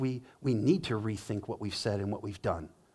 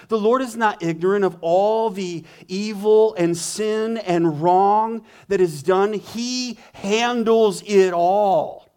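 preaching at full force; 7 semitones higher, 9 dB louder than usual. Real, not acted.

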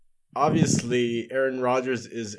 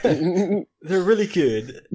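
mock British accent, laughing, slow; 5 semitones higher, much slower than usual. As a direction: say it like a Brit, half laughing. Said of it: "You have to say it in a, in a British accent".

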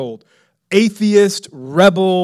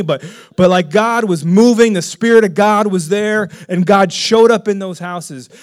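ordinary talk

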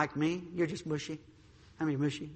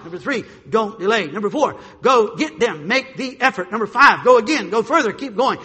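rising louder and higher, point after point; building preaching; 5 semitones higher, 12 dB louder than usual. Acted. Preacher driving the congregation to go out. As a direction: preach it harder with each number.

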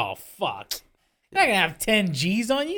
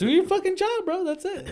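much higher-pitched retort; mock dismissive; 13 semitones higher than usual. Telling the cop to get on with it, dismissive. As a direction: insist in a high acted voice. Acts out brushing off the cops.

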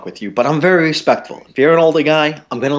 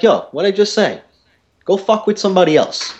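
irritated retold comeback; 8 semitones higher than usual. Retelling his angry comeback.

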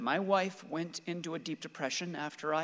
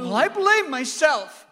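loud, high, slow cry of self-blame; anguished shout; 10 semitones higher, 11 dB louder than usual. Acted. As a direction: hurl the guilt out at the top of your voice. Father's grief outburst over son.

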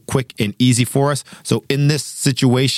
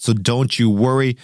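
ordinary talk, nothing marked

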